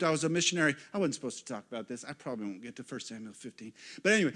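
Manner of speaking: ordinary talk, nothing marked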